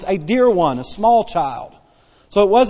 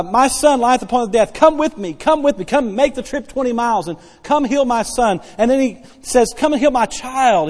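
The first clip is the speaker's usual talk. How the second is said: higher, pleading father voice, begging for his son; desperate pleading father; 4 semitones higher than usual. Acted. Nobleman begging Jesus for his dying son, desperate pleading. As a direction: beg like a father whose child is dying. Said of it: "can you hear the desperation in his voice".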